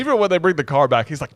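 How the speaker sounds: ordinary delivery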